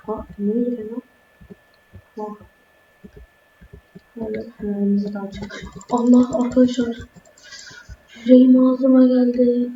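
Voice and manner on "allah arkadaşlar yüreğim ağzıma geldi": startled loud outburst to viewers; jolted out of him, much louder; real fright. Real, not acted. Startled by a game jump scare, a loud frightened cry. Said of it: "Çok korktum".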